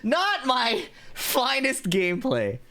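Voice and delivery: slow, high ironic sigh; drawn-out self-mocking. Real, not acted. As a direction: stretch it out, mocking yourself. Laughing after dying.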